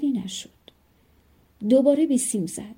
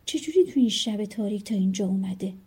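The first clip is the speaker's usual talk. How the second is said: ordinary delivery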